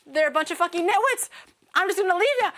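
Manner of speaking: high, exasperated mock rant; frustrated local. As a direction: imitate a fed-up local giving up. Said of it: "the local is also frustrated".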